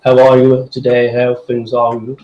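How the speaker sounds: put-on posh English voice; upper-crust English accent impression. Shown as a demonstration. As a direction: mimic a very posh English speaker. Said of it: "from a very posh person".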